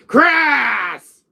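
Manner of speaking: hyper villain shout; high, long-drawn bellow of a name; Wesker. Acted. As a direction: yell it out like an enraged villain. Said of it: "How he gets, uh, a little hyper".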